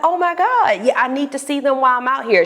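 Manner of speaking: high, fast, thrilled reply; excited. Retold or imitated. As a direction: gush with excitement. Excited to see friends.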